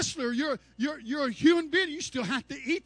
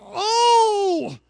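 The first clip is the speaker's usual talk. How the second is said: longing cry; 7 semitones higher, 9 dB louder than usual. Retold or imitated. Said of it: "And he said".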